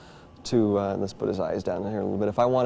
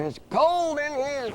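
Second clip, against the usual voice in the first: high, slow puppet whine; slower than usual. Acted. Dummy Orson complaining to the ventriloquist, high slow whine.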